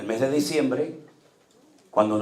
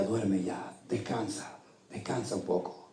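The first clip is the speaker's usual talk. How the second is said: much softer, hushed lulling to sleep; soft soothing wife; 3 semitones lower, 10 dB quieter, slower than usual. Retold or imitated. Wife at night soothing her weeping husband, soft and hushed. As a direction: coax a sleepless husband to rest.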